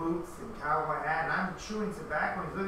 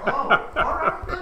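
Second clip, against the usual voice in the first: much higher, laughing mock surprise; 10 semitones higher, 9 dB louder than usual. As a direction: squeak it out through laughter. Retelling own startled reply.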